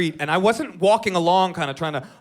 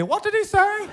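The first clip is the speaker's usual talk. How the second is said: much higher, squeaky mock Irish puzzlement; 13 semitones higher than usual. Comic mimicking how the shocked Irish crowd sounds to him, in a mock Irish accent. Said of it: "that's how Irish people sound to me".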